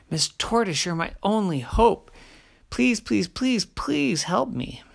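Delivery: high, desperate pleading; please please, begging for help; begging bear. Acted.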